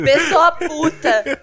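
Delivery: laughing. Real, not acted. Laughing remark.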